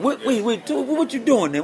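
surprised stop. Retold or imitated. Retold surprised mentor quote.

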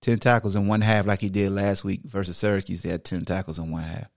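ordinary delivery

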